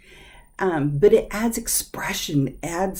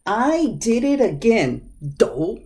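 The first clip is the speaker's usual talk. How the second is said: annoyed at self; 4 semitones higher, slower than usual. Shown as a demonstration. Teacher acting out a self-annoyed doh. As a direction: groan at your own mistake. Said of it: "you add expression to them".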